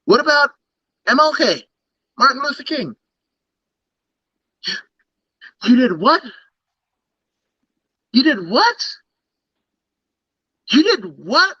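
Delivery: God in mock disbelief, pitch climbing very high; playing God. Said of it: "he's playing God".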